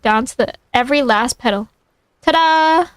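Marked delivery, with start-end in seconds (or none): 2.2-2.9 Sandy's voice. Acted. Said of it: "Sandy said".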